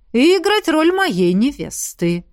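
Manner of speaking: slow, cheerful finish; cheerful dragon. A narrator's reading. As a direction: finish it with a grin. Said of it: "жизнерадостно закончил". (жизнерадостный низкий голос)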